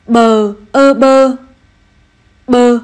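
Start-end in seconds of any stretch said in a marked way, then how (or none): 0.1-1.4 slow, stretched syllable-by-syllable spelling; spelling it out slowly. Shown as a demonstration. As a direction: sound out "Bờ, ơ bơ" one piece at a time, a touch louder. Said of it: "cùng cô đánh vần". (đánh vần chậm rãi, tách từng tiếng "Bờ, ơ bơ")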